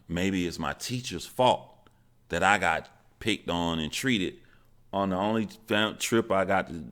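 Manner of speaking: ordinary delivery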